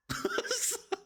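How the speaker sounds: high-pitched burst of laughter. Real, not acted. Self-mocking dork after police jargon, high giggle. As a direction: laugh at your own nerdiness. Laughs at own nerdy remark.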